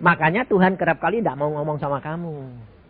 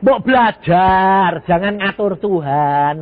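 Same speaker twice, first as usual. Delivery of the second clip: drawn-out, louder admonition; slow firm scolding; 3 semitones higher, 5 dB louder, much slower than usual. Real, not acted. Preacher scolding the congregation, slow and loud. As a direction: spell it out slowly so they learn.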